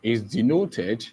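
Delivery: measured, repeated phrases for note-taking; slow dictation. Real, not acted. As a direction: dictate it bit by bit with long gaps. Dictates notes to the class.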